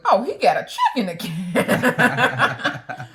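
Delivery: slow, loud, laughing aside to herself; amused inner aside. Retold or imitated. Wife noting husband's check, laughing slowly. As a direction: drawl it out with a laugh.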